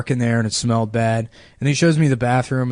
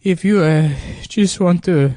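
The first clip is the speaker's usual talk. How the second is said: put-on higher, halting Mexican-accented plea; mock near-tears, with long pauses in a Mexican accent; tearing up; 6 semitones higher than usual. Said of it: "And he's, like, tearing up".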